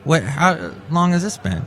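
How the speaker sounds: usual speaking voice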